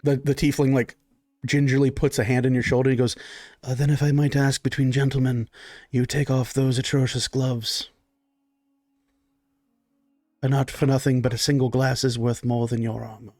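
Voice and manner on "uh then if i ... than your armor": lightly voiced butler, politely disdainful; a hint of haughty waiter; discreet servant. Servant discreetly chides guest.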